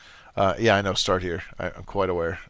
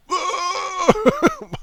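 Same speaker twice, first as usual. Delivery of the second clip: shocked laugh; 4 dB louder than usual. Real, not acted. Reacting to five billion years.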